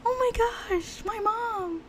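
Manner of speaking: high, slow mock-whiny voice; hammed-up childish wail; mock whiny kid. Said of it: "He's probably gonna be like".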